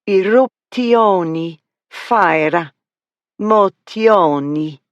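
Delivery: put-on slow Italian accent, vowels tacked onto endings; extremely Italian accent. A narrator's reading. Narrator demonstrating Miss Flower's word endings, exaggerated Italian accent. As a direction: savour each word in overdone Italian. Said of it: "rolled the R's and added extra vowels to the ends of the words".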